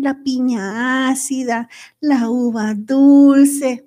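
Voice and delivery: muy lento, saboreando cada palabra; savoring. Acted. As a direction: alarga «la uva dulce» como si la probara. Saboreando frutas en la charla. (saboreando despacio)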